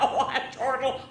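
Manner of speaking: high voice breaking with laughter; chortling. A narrator's reading. Bismarck helpless with giggles, chortling. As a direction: squeeze the words out mid-laugh.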